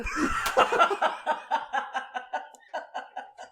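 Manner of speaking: long high-pitched fit of laughter; helpless laughter that keeps on going; laughing. Real, not acted. Cracking up at own story.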